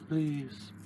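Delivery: faint gasped last word; dying gasp. Pretend. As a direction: gasp it out, fading.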